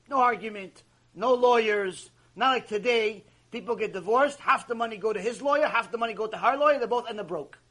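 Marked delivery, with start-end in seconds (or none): none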